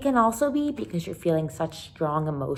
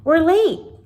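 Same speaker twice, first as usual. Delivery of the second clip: much higher and louder, hurried; urgent Caroline; 11 semitones higher, 8 dB louder than usual. Acted. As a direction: call it out as she pulls him running. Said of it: "she said".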